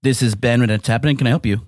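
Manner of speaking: deep producer voice. Pretend. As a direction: answer like a radio producer. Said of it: "[name]'s pretending to be a producer".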